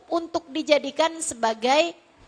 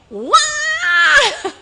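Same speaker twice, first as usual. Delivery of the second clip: sudden high, loud bawl; piercing fake toddler wail; mock child's wail; 8 dB louder than usual. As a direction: imitate a child crying in earnest. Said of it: "begitu anak nangisnya serius nih".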